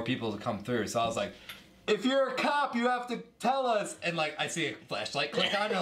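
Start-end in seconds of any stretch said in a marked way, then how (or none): 1.9-4.0 much higher, high-pitched challenge; calling to the door. Retold or imitated. Friend calling to someone knocking at party door, high challenge. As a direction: call it out high, half-joking.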